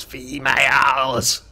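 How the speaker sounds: slow, drawn-out Ferengi leer; very slow alien sneer; Ferengi impression. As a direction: stretch it out like a leering Ferengi. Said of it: "the Ferengi guy that goes".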